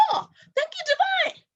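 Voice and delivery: high, bright cry of thanks; delighted thanks. Retold or imitated. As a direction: gush with gratitude to heaven. Grateful exclamation to the divine.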